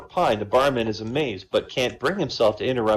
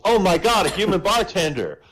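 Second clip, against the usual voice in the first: mock-astonished duck; 7 semitones higher than usual. Duck gaping back at a human bartender, mock astonished. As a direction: throw the amazement back, higher pitched. Duck mimics the barman's shock.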